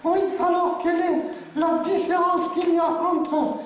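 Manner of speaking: high, slow puppet voice; cheeky puppet. Acted. Puppet asking the ventriloquist her riddle, slow high puppet voice. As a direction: pose the riddle slowly as a high-voiced dummy.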